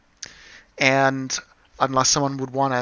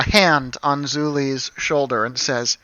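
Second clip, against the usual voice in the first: ordinary talk, nothing marked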